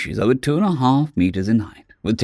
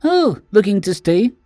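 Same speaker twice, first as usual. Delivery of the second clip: timid small creature; 9 semitones higher than usual. Acted. Small Keswick greeting the towering Synexian, high and timid. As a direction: sound small and hopeful.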